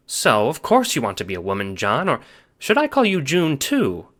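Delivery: lightly voiced Nana; Nana's voice. A narrator's reading.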